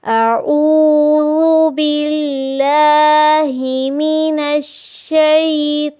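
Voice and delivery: slow, melodic tajweed recitation; recitation to repeat. Shown as a demonstration. Teacher recites so students repeat three times after her. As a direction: recite it slowly for students to echo. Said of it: "मेरे साथ तीन मरतबा दोहराइए।".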